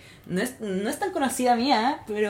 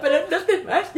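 much higher, laughing plea to stay; mock begging; 9 semitones higher, 6 dB louder than usual. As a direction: whine it as a joke. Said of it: "Y yo".

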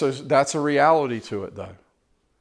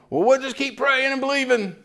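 much higher, lightly voiced churchgoing lady; the lady's voice; 12 semitones higher than usual. Unhealed lady leaving, imitated with a much higher voice. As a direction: chirp it like a faithful sister. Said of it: "She's walking away going".

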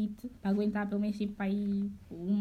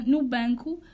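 normal talking voice

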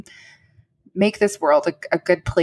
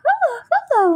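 put-on a much higher little-girl hello; toddler voice; much faster than usual. Two-year-old sister greeting the new baby, high toddler voice. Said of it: "she was going".

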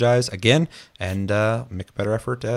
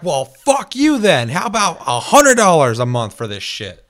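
slow, loud, high spiteful retort; mock spiteful company; 6 semitones higher, 7 dB louder than usual. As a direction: snap back like a greedy company, hammed up. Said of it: "They're like".

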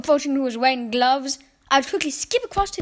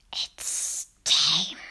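very slow, low, whispered coaxing; rasping witch whisper; 8 semitones lower than usual. Acted. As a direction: lure the child down with a creepy hiss. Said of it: "Her voice had a curious rasping quality".

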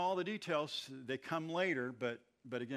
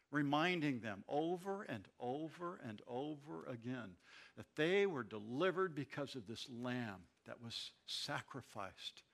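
ordinary delivery

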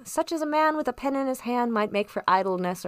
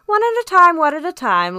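high, loud interjection, easing off; amused doctor, laughing; 8 semitones higher, 8 dB louder than usual. A narrator's reading. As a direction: playfully break up two people talking at once. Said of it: "laughed Doctor Livesey".

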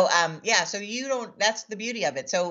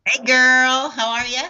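excited greeting; 7 semitones higher, 7 dB louder, slower than usual. Real, not acted. Guest greets host loudly.

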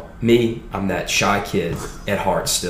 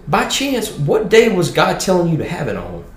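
put-on Southern Baptist old boy; 9 semitones higher than usual. Dean of students reassuring the scared kid, hammed-up high Southern voice. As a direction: play the hearty Southern dean, pitched way up. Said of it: "a good Southern Baptist old boy".

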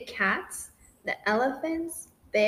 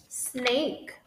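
ordinary delivery, nothing marked

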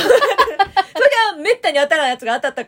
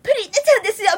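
much higher, louder mock sales cheer; high-energy clerk; 10 semitones higher, 6 dB louder, much faster than usual. Clerk announcing the prize mascot, in an imitated excited voice. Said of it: "すごいテンションで言われた".

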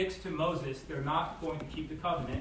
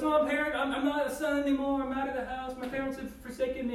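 put-on higher, whiny kid feeling abandoned; whining child; 7 semitones higher, faster than usual. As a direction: play the hurt kid, overdramatic. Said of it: "And the kid goes".

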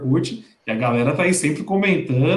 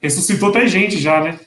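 ordinary talk

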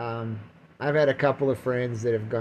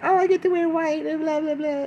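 very high mock bride voice, trailing into a fake sob; giddy bride; 14 semitones higher, 3 dB louder than usual. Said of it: "the woman's like".

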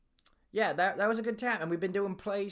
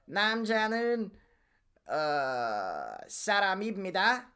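fast Korean line in a Southern accent; Korean accent through a cowboy drawl; 2 semitones higher, faster than usual. Shown as a demonstration. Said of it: "a Korean accent performed by someone with a Southern cowboy accent".

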